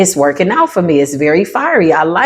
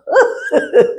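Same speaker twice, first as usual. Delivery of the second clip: high laugh; 13 semitones higher than usual. Real, not acted. Laughing at the enemy.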